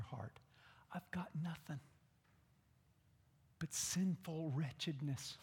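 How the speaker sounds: soft broken whisper; whispered confession. Shown as a demonstration. A sinner whispers his plea to God. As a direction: whisper it with nothing to offer. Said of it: "whispered sinner's plea".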